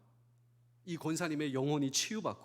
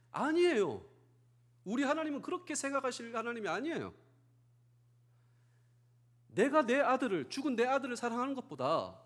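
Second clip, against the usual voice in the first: impassioned protest; 6 semitones higher than usual. Acted. Deaconess's emotional tearful reply.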